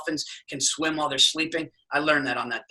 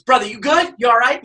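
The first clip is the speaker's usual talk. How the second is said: higher, louder friendly call across water; Hawaiian guy calling; 6 semitones higher, 7 dB louder than usual. Retold or imitated. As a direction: shout out a concerned Hawaiian guide. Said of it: "He was, like, a Hawaiian guy".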